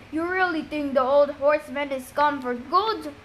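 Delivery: gruff guy's voice, put on; rough up the voice for a gruff henchman; lightly acted gruff questioning; gruffer voice. A narrator's reading. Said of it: "deeper and gruffer".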